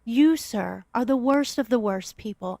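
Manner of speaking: ordinary delivery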